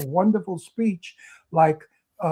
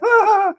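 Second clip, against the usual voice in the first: mock stupid guffaw, high and loud; stupid laugh; 7 dB louder than usual. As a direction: blurt out a dumb high laugh.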